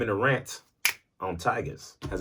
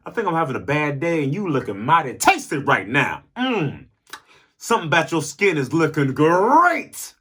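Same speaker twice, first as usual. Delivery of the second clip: hungry tiger; 8 semitones higher, 5 dB louder than usual. Pretend. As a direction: purr it like a tiger eyeing dinner. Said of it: "Tigers are like".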